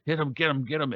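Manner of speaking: high, eager urging from the corner; cornerman egging on. Retold or imitated. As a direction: cheer your fighter on, high and keen.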